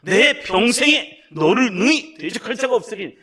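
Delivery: "내 평생에 너를 능히 대적할 자가 없으리니"를 배에 힘주고 또렷또렷 크게; 성도들에게 보여 주는 바른 읽기, 힘찬 목소리; 힘차게 또렷이. Shown as a demonstration. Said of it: "배에 힘 빡 주시고 목소리 힘을 빡 주시고". (배에 힘을 빡 주고 또렷하게 크게)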